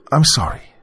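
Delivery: forced out. A narrator's reading. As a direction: squeeze out a difficult apology. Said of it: "I forced out".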